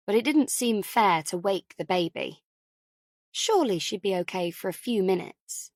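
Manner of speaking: ordinary delivery, nothing marked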